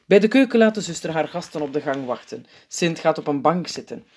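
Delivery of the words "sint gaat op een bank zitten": ordinary delivery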